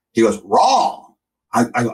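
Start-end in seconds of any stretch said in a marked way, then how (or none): 0.4-1.1 higher-pitched Southern kid imitation; name slurred into one Deep South syllable; Deep South accent. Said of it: "deep, deep South accent".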